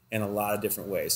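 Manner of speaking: normal talking voice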